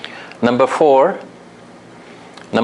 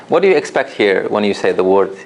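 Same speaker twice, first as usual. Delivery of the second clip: ordinary talk, nothing marked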